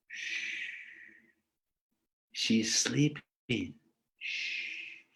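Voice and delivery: whispered shush, sneaking into a house; hushed whisper. Retold or imitated. Krishna hushing his friends while sneaking in, whispering. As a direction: hush the friends in a breathy whisper.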